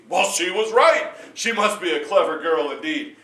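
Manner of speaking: loud, pleased king; a king impressed despite himself; booming king. Acted. King voices approval.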